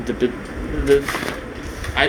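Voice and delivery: ordinary talk, nothing marked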